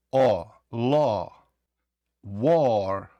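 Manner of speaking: "ло" and "war" with a long O vowel; вимова як о. Shown as a demonstration. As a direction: hold a rounded O in "ло" and "war". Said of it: "то тоді вона вимовляється як". («ло», «war» з довгим «о»)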